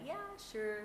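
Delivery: high, sweet, falsely agreeable; fake-smiling agreement. Host answers a late client, fake smiling. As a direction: agree through a forced smile. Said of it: "will smile and be like".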